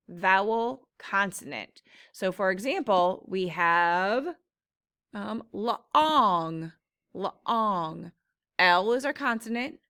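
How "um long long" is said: "laong" drawn out with an open ah; stretched ah in long. Shown as a demonstration. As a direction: sound out "laong" slowly with a wide ah. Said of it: "It makes the ah sound".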